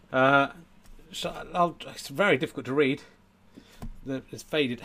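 ordinary talk

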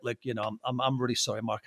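low, soft, contrite mumble; retold sheepish apology. Storyteller re-enacts apologising backstage to a celebrity, low sheepish apology.

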